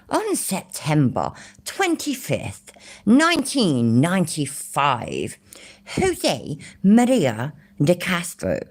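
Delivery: slow, mock-posh British reading. British streamer reading a court record aloud, mock posh voice. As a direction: put on a posh British accent, drawn out. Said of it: "shall I try and do a posh voice".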